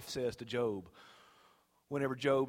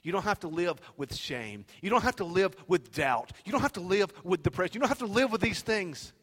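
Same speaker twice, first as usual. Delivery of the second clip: rising, ever louder repeated declarations; building preaching; 7 semitones higher, 6 dB louder than usual. Real, not acted. Preacher freeing listeners from shame and doubt, building fervour. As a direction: build from firm to high and forceful.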